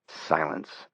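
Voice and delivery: usual speaking voice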